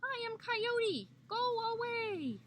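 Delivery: high, loud defiant yell; yelling Coyote. A narrator's reading. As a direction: yell it back at your pursuer. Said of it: "he yelled back at the rock".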